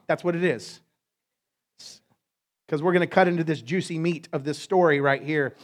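normal talking voice

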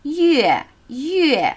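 "Yue" starting high and dropping; falling tone. Shown as a demonstration. Teacher showing the word for month, falling tone. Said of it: "Zwei fallende Töne".

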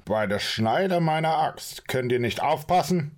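a dwarf furious over spilled soup; angry outburst. Acted. Torvald yells at Orin and Maki over the spilled soup, angrily.

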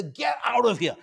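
loud, high "Get out of here!"; incredulous shout. Retold or imitated. Elaine pushing Jerry, shouting in mock disbelief. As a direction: shove the words out in disbelief. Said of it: "She'll push Jerry, say".

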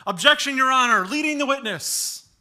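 loud, high cry of objection; mock courtroom shout. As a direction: jump up and shout it like a lawyer. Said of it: "jump up and shout".

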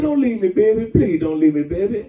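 loud pleading lover; begging an ex back. Acted. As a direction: plead loudly, repeating baby.

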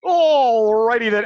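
slow, loud catchphrase impression; hammed-up, drawn-out signature line; Jim Carrey. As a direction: belt out the first word and stretch it. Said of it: "name was Jim Carrey".

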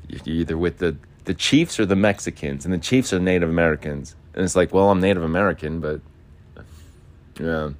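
ordinary talk

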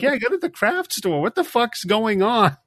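high, fast exasperated mom; high-pitched mom voice. Retold or imitated. Mom answering daughter.

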